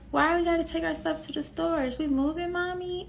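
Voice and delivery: a hint of a curious young daughter; child's question. Voicing her little daughter.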